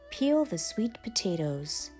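usual speaking voice

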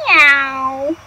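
voiced meow like a cat. Cat answering Duck with a meow. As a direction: give a little cat's meow.

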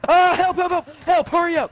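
high, loud panicked yelling; panicking cry for help. Real, not acted. Getting shot, calling for help.

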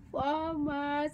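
real laugh spilling into the word farmer. Real, not acted. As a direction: crack up while saying farmer. Gossiping about Pendo's boyfriend.

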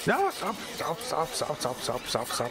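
high frantic plea; panicked. Real, not acted. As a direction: cry out in panic. Attacked in cutscene.

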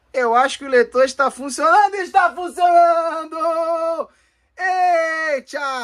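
building to a high, drawn-out shout; excited celebration. Real, not acted. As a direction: let the last words ring out high and long. Celebrates the console reader working. (comemoração empolgada, gritada e esticada)

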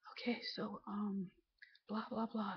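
hushed, barely audible mumbo-jumbo; mimicked quiet talking. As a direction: imitate someone talking far too softly. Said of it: "Why are you speaking so quietly?".

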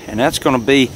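ordinary talk

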